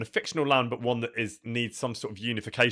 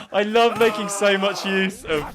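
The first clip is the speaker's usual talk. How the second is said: laughing; 10 semitones higher, 5 dB louder, slower than usual. Real, not acted. Laughing while talking.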